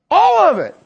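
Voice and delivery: high, loud proclamation; fervent preaching. Acted. Preacher answers his own question, high and loud. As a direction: shout the answer to the church.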